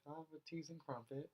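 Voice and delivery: put-on British accent. Shown as a demonstration. Co-host demonstrating a British accent on request, put-on British voice. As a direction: attempt a quick, light British accent. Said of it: "What's a British accent? Do one".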